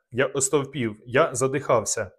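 usual speaking voice

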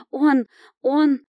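high, loud frantic pointing cry; panicked accusing. A narrator's reading. Girl accusing the stranger before the knights, frantic.

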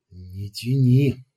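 slow, low threat; quiet menace. A narrator's reading. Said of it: "негромким, но таким устрашающим".

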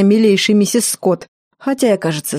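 ordinary delivery

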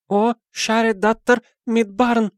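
slow, very high motherly wail; high, drawn-out emotional exclamation; crying out. A narrator's reading. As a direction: cry out slowly in a high woman's voice. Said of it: "Вскричала она".

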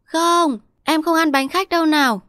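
high, loud bratty refusal; tantruming little boy. A narrator's reading. Spoiled boy refusing his mother's offer, high loud tantrum. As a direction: wail it out like a spoiled child. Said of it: "Cậu Mai dãy đành đạch".

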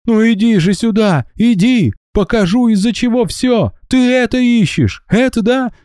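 high, strained spectral pleading; pleading ghost. A narrator's reading. Ghost of a young man begging Max, high desperate call. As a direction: beg him to come, like a voice from the fog.

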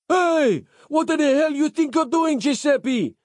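very high-pitched outraged holler; shouting to intervene. A narrator's reading. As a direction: holler across the restaurant, voice way up. Customer rushes in to intervene.

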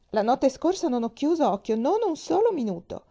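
high, loud lament; complaining. A narrator's reading. Invalid telling the girl about her sleepless night, complaining. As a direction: complain emphatically, pitch climbing to the end.

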